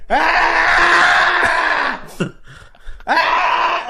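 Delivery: high, drawn-out wailing shriek; long imitated scream. Friend mimics the screaming man heard from the lobby, long shrieks. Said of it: "Like, really long stretches of".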